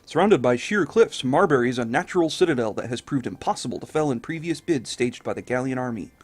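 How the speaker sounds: formal newsreader voice; news read. Acted. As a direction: read it like a newspaper headline story. Reads news article aloud.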